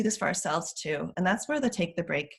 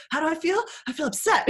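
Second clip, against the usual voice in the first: put-on higher, louder petulant child; mock upset kid; 11 semitones higher, 5 dB louder than usual. Parent imitating a child asked too pointedly, high and sulky. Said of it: "can be a little bit too pointed".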